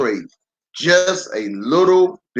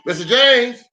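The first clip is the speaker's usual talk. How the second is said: raised, higher call of a name; 8 semitones higher than usual. A narrator's reading. Teacher calling the daydreaming student, a raised higher call. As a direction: snap him out of his daydream by name.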